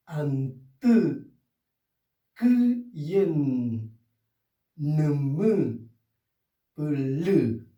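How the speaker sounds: each Mapudungun word spaced out and said clearly for students; careful word drill. Shown as a demonstration. Teacher modelling words for students, careful pronunciation. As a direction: sound out each word for the class.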